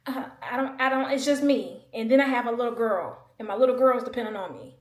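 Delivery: real near-tears venting; emotional. Real, not acted. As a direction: voice strained with real upset. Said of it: "I got really emotional".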